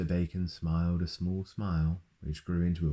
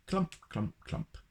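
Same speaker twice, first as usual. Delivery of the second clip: high sing-song clump sounds; stomping footsteps; 11 semitones higher, 4 dB quieter than usual. A narrator's reading. Giant steps down the hill, playful clumping. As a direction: mimic heavy footsteps.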